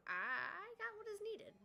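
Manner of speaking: high, loud and slow triumph; excited. Real, not acted. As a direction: announce it in triumph. Got the game item.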